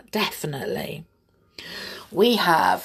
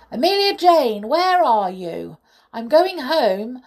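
higher, louder call for someone; 6 semitones higher, 6 dB louder than usual. A narrator's reading. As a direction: call out to find a missing friend. Bear calls out for missing doll.

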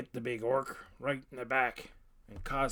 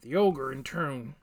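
ordinary delivery, nothing marked

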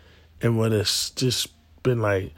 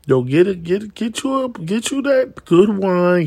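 usual speaking voice

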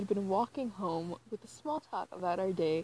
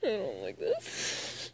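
slow whimper; 4 dB quieter, much slower than usual. Real, not acted. Dread while reading.

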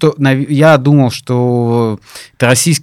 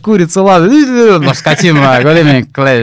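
high, loud garbled mumble; mumbled pilot gibberish; 6 semitones higher, 4 dB louder than usual. Retold or imitated. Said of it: "И там то же самое".

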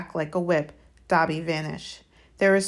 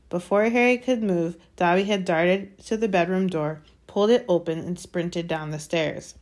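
ordinary delivery, nothing marked

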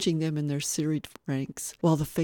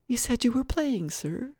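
put-on higher, earnest child voice; polite little boy; 8 semitones higher than usual. Little boy questioning the guest, politely puzzled. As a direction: speak as a small, courteous boy.

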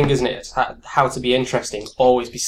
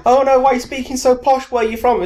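higher-pitched imitation of suspicious locals; mocking accusers; 11 semitones higher, 3 dB louder than usual. Imitating locals who think the speaker posh, mocking. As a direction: mimic their complaint, pitched well up. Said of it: "I was always told that I speak posh".

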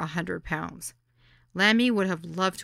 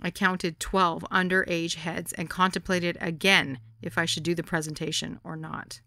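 plain reading voice

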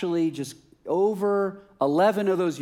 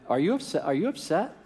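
lightly acted, rising incredulous question; amused incredulous questioning; 3 semitones higher than usual. New convert asking his longtime friend about being upset, amused incredulity. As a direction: tease out the suspicion, imitating a friend.

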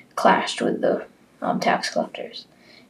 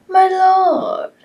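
servant calling; 14 semitones higher, 7 dB louder than usual. Acted. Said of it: "said, um, a servant".

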